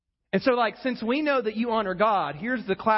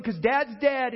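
ordinary talk

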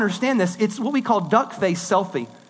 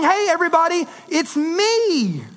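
mock selfie greeting; 7 semitones higher than usual. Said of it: "this way of saying".

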